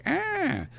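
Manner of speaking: put-on high, short baby "ah"; imitated baby wail. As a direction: mimic a newborn's cry. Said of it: "that's what babies make".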